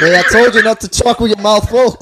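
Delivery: high, loud telling-off through laughter; playfully chide, laughing as you go; mock scolding. Friend teases the choker for talking while eating, mock scolding.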